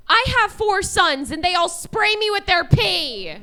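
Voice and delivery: loud, high imitation of an outraged heckler; hammed-up indignant shout; mimicked heckler yell. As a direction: holler it as the woman at the back. Mimics the same heckler again.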